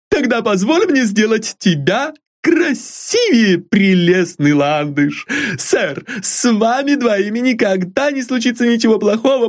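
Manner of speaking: very high, doting servant cooing fondly; an old servant fussing over her mistress; high, fond, bustling maid voice; fond exclaim from old maid. A narrator's reading.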